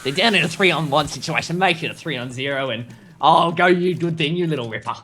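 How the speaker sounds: mock Ocker Australian play-by-play; Ocker Australian accent. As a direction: call the round like a caricature Australian. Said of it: "our best Australian Ocker impression".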